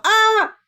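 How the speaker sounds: high, loud startled yelp; playful fake shriek; mock yelp. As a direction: yelp like something was thrown at you. Yelp voicing clip.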